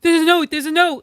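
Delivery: hammed-up excited alert; mock urgency. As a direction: call out the discovery excitedly. Excited shout retold from film.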